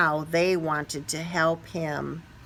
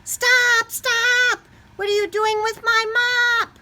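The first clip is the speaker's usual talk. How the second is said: high, loud, frantic shout; janitor yelling; 5 dB louder than usual. Acted. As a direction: chase him down with a shout. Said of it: "somebody yell".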